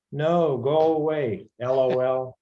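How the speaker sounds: usual speaking voice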